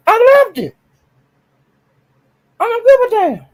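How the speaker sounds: delighted, carefree squeal; excited squeal. Real, not acted. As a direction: gush about it like a fan. Gushing over DG's stand.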